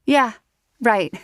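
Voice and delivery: scoffing. Acted. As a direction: toss it off with disdain. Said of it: "She scoffed".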